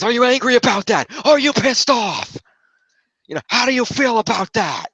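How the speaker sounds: high, taunting mock Foley, slowing on pissed off; goading Foley. Foley provokes Sami, high and taunting. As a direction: needle him to get a rise.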